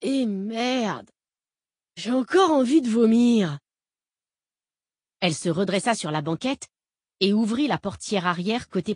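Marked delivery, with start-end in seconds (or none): 0.0-3.6 put-on high, loud nauseous drunk wail; queasy drunk woman. A narrator's reading. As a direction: blurt it like you're about to be sick. Fake nauseous outburst.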